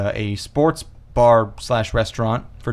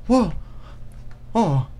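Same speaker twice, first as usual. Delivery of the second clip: imitated wounded "oh"; very hurt; 9 semitones higher, 4 dB quieter than usual. Retelling a friend stung by a passing insult, hurt. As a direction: voice a sensitive friend taking offense. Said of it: "it was a very hurt".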